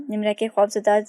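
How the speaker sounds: ordinary delivery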